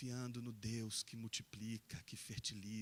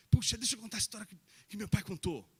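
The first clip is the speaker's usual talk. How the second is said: put-on eager child; 9 semitones higher, 12 dB louder, faster than usual. Boy Isaac eager to tell friends.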